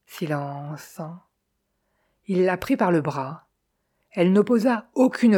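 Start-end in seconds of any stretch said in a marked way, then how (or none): none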